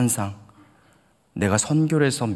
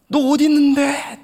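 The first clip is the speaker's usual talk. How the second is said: high loud call; alarmed question; 6 dB louder than usual. Retold or imitated. Older brother calling back down the phone, high and loud. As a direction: demand where he is, alarmed. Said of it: "제가 이랬어요".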